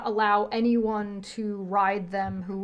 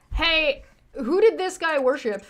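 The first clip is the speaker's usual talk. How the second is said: lightly voiced doctor, higher and louder; questioning doctor character; 7 semitones higher, 5 dB louder than usual. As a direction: call out a puzzled question, pitched up.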